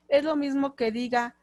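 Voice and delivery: normal talking voice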